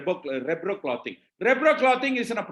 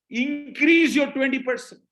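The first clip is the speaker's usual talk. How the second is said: higher and louder, slow and emphatic; rallying call; 5 semitones higher, 5 dB louder than usual. Real, not acted. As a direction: drive the rule home like a rallying cry. Closing rallying call to audience.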